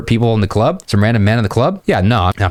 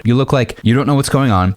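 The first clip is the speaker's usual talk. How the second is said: usual speaking voice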